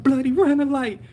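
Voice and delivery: high, heated yelling; retold angry cussing. Injured driver complaining to a bystander, mad high-pitched yell. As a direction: rant furiously as in the retold moment. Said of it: "cussing, like".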